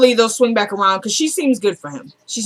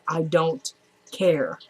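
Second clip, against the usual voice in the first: low, quiet, drawn-out defiance; defiant indifference; 4 semitones lower, 6 dB quieter, much slower than usual. Retold or imitated. Wife answers husband's divorce warning, slow low defiance. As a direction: imitate a wife coolly refusing, word by slow word.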